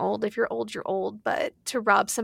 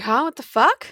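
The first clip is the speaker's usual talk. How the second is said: shocked; 4 semitones higher, 4 dB louder than usual. Retold or imitated. Judy voiced reacting to cancer news.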